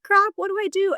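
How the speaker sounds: high, panicky outburst; panicked. Retold or imitated. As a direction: blurt it out in a panic. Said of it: "and you're like".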